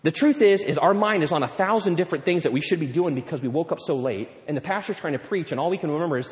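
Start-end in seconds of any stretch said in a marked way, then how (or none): none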